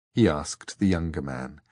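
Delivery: ordinary delivery, nothing marked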